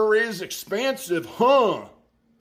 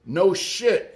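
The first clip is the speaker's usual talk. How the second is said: usual speaking voice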